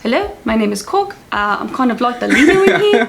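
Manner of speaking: put-on casual New Zealand accent; chatty Kiwi introduction; easygoing New Zealand accent; Korg. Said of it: "when I think of New Zealand is Korg".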